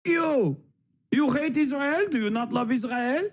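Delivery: mock Israeli accent, high and indignant. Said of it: "I wish I could do an accent".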